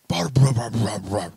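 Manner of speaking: loud bah bah bah, imitating the fall; mock crash and thud noises. Dad imitating his daughter falling in the shower, loud mock thuds. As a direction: bang out the noise of her falling. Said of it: "I hear this horrible commotion".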